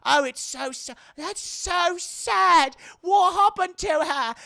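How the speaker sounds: very high, loud, slow mock pity; high loud drawn-out fake pity; fake sympathy. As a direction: whine it like fake concern. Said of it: "fake sympathy".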